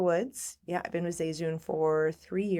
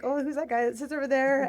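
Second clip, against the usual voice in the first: nervous inner voice; 8 semitones higher than usual. Retold or imitated. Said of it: "I was like".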